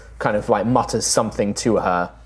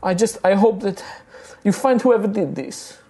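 upset halfling woman; 10 semitones higher than usual. Acted. Rosalina consoling the party.

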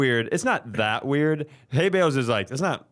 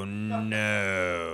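drawn-out dread; 6 semitones lower, 5 dB quieter than usual. Real, not acted. Reacting with dread to the story.

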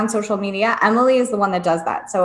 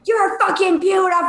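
put-on giddy party girl; 8 semitones higher than usual. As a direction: gush like a drunk stranger in the bathroom. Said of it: "there's this girl in there that's like".

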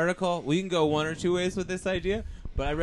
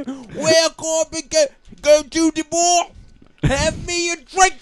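loud, slow, much higher mock Australian voice; bad Australian accent; 11 semitones higher, 7 dB louder, slower than usual. Friend performs his bad Australian surfer voice. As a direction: ham up a terrible Australian surfer. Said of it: "this is an [name] voice".

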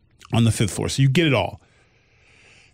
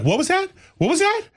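very high shocked double question; a mother's shrill alarm, much higher. Retold or imitated. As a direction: repeat it in startled disbelief. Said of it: "Her mother was like".

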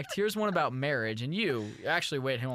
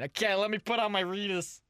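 Missus Weiss; 4 semitones higher than usual. Pretend. Host plays a guest's mother asking for her son, high and fretful. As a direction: fret like an anxious mom looking for her son. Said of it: "Ryan's mom".

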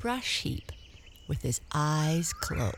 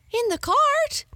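very high, loud disbelief; shocked exclamation; 8 dB louder than usual. Acted. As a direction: shriek it in astonishment. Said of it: "exclaimed his wife".

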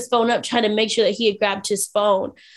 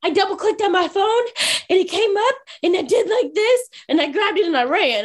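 higher, eager retelling of his defence; imitated excited explaining; 7 semitones higher than usual. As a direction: play a companion eagerly explaining himself. Companion excitedly explains his snack purchase.

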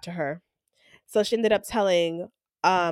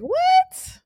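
very high, squeaky mock-incredulous question; shocked disbelief. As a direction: react aghast, pitch shooting up. Retold shocked reaction to offensive remark.

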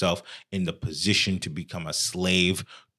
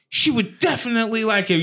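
mock eager reply; 13 semitones higher, 3 dB louder than usual. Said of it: "he was like".

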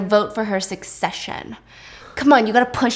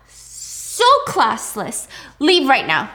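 contemptuous; 4 semitones higher, 3 dB louder, much slower than usual. Acted. Grandmother dismisses the maid.

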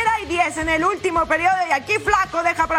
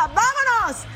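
touchdown call; 8 semitones higher, slower than usual. Real, not acted. Touchdown call on long catch.

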